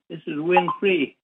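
ordinary talk, nothing marked

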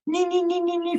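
high nee-nee siren sound, imitating a police helicopter; siren imitation. Dad imitating the police helicopter siren overhead, mock siren. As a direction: chant the siren like a police chopper.

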